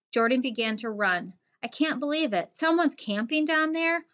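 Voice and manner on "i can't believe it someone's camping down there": excited. A narrator's reading. Running toward a found tent.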